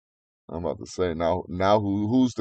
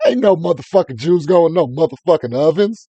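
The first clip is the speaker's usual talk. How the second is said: higher, louder put-on rant; 8 semitones higher, 5 dB louder than usual. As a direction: rant in a mock rocker voice. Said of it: "we'll do it all in [name]'s voice now".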